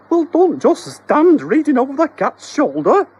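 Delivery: very high, loud, slow spluttering wife. Acted. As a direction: splutter it out at your dithering husband. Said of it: "spluttered Missus Doherty".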